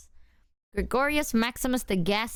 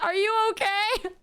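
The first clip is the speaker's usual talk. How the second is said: cat voice; 10 semitones higher than usual. Retold or imitated. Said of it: "he was like".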